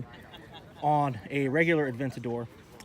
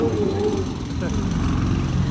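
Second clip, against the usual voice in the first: laugh; 9 dB louder than usual. Real, not acted. Laughs after squealing Porsche.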